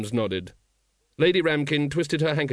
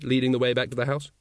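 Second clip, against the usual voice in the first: ordinary delivery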